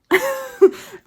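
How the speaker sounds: a high, loud giggle; high embarrassed laugh. Real, not acted. Laughing after admitting she hides her teeth.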